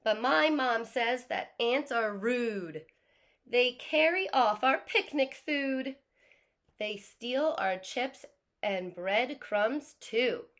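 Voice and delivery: put-on loud, slow, accusing kid. A narrator's reading. Teacher voicing the kid blaming ants, loud and slow. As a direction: lecture the ant about stealing. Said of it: "The kid is speaking".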